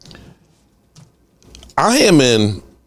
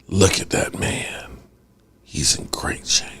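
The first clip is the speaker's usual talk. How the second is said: low, hushed voice sinking to a whisper; 6 semitones lower, 5 dB quieter than usual. Retold or imitated.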